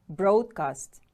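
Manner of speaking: "Broadcast" punched on BROAD; first-syllable stress. Shown as a demonstration. Teacher models the word for pupils, "Broadcast" stressed firmly on BROAD. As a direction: hit the start of "Broadcast" firmly: BROAD-cast. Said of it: "Stress is on the first syllable".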